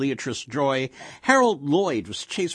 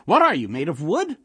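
put-on exasperated old wife, raised voice; louder, higher jab at her husband; demanding; 5 semitones higher, 6 dB louder than usual. A narrator's reading. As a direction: goad him for an answer. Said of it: "she demanded".

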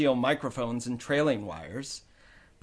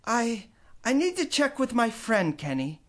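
higher, lightly voiced faltering mother; hesitant mother; 8 semitones higher than usual. A narrator's reading. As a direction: hedge about the new man. Mom hesitating.

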